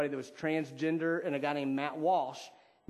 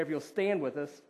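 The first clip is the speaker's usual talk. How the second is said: ordinary talk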